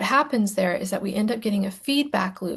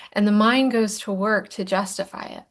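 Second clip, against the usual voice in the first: ordinary delivery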